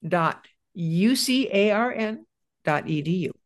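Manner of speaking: slow, spaced-out web address; deliberate dictation with gaps between the parts; reading slowly. Real, not acted. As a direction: spell it out slowly, piece by piece. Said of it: "I'll read this slowly".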